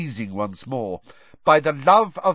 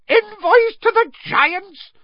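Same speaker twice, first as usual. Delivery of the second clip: much higher, louder, slow disbelieving shriek of envoys; 6 dB louder than usual. A narrator's reading. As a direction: shriek the words back in horror. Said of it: "Fudge shrieked".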